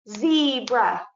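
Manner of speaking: Zebra split slowly into ze and bra; chopped into syllables. Shown as a demonstration. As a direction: sound out Zebra one syllable at a time. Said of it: "chop the syllables".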